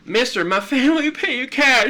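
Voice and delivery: high, loud, exaggerated Southern whine; mocking Southern plea. Dad mocking his daughter's heavy Southern accent, high and loud. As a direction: overdo the Southern begging voice to tease. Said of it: "your Southern accent was a little heavy".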